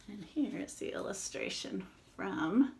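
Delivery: hushed whisper. Real, not acted. Aside to kids showing picture.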